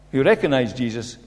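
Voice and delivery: ordinary talk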